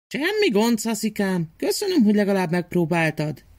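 put-on high, kindly old aunt voice. Old aunt gently thanks Szaszi, high kindly voice. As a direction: thank the little boy in a high old-lady voice.

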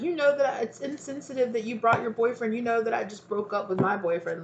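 high-pitched indignant complaint; aggrieved friend. Acted. Acted complaint of upset friend.

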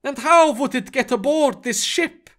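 high, questioning objection; incredulous protest. Acted. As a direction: object in disbelief, pitched well up. Eric keeps protesting.

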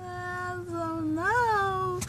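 high, stretched-out mock wail; drawn-out whine. Storyteller replaying her indecision about the boy, high slow whine. Acting out her hesitation over crush.

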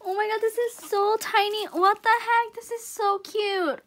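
high-pitched cooing over something tiny; delighted gushing. Real, not acted. Gushing over tiny music box.

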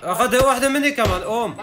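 excited shout. Real, not acted. Excited call during firefight.

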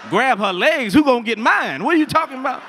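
loud, rising disbelief at GRAB HER LEGS; mock outrage, voice climbing as you object; incredulous protest. Scared son balks at father's order, incredulous.

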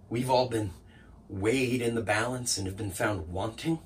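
low, hushed realisation; quiet hurt. Acted. Assistant realising nobody is worthy, quietly wounded. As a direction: let the anger drain to near nothing. Monologue sinks into hurt resignation.

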